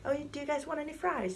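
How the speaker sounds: high, sweet friendly offer; Haley offering fries, high and sweet. Retold or imitated. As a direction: offer the fries kindly and lightly.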